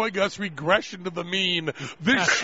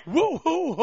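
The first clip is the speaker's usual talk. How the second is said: high mock cheer; playful whooping, pitched up; celebratory whoop; 5 semitones higher than usual. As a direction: imitate a fan's woo-hoo. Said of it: "throw your fists in the air and go".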